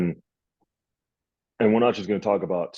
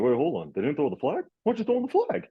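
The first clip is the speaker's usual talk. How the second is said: mock outrage rising higher and higher; retold disbelief; 8 semitones higher, faster than usual. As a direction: replay the moment you couldn't believe the missed call. Retelling disbelief at missed flag.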